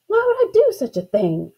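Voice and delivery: high-pitched, affronted question; indignant demand. A narrator's reading. Girl challenging her brother's suggestion, indignant demand. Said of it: "I demanded to know".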